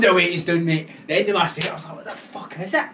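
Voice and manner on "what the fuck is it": squaring up to gossipers, mock aggressive; angry retold challenge. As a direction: bark it after slamming weights. Retold confrontation.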